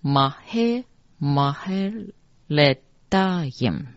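Mahe Mahe Ledayim, slowly syllable by syllable; mantra pronunciation. Shown as a demonstration. Teacher reading line twenty-six for learners, slow pronunciation demo. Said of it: "念成".

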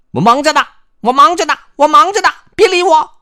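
high, loud repeated protest, building; irritated brush-off. Acted. Busy character tells visitors to leave, irritated high shout.

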